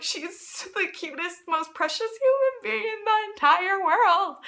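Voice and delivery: high, drawn-out adoring gush. Retold or imitated. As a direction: gush like a smitten fan, loud by the end. Gushing about singer in retelling.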